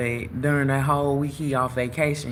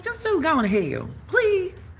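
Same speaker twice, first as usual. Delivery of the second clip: much higher, exasperated brush-off; exasperated plea; slower than usual. Retold or imitated. Telling the pestering guy to go to hell, high fed-up cry. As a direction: shoo him away at the top of your voice. Fed up with his constant calls.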